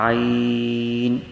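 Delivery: عین stretched very long from the throat; drawn-out letter. Shown as a demonstration. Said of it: "اس طریقے سے اس کو پڑھیں گے".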